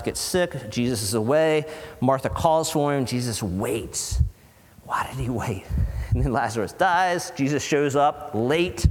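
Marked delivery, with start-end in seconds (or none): 4.8-5.6 hushed, breathless question to the room; a soft whisper that invites them closer. Real, not acted. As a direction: lean in and whisper it. Rhetorical question hushed to congregation.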